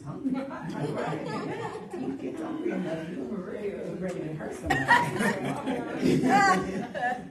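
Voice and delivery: half-joking, cracking up as she says it; laughing. Real, not acted. Joking about hunger rage.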